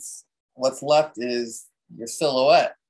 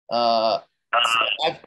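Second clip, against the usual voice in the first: ordinary delivery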